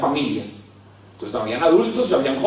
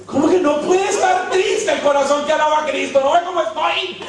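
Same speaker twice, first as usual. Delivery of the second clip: much higher, incredulous comic protest; mock outrage; 10 semitones higher than usual. Preacher ridiculing the songwriter, high laughing disbelief.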